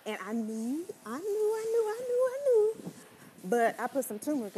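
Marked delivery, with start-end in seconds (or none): 1.1-2.9 much higher, louder sing-song. Real, not acted. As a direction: sing it out in mock dread. Knowing cayenne would burn.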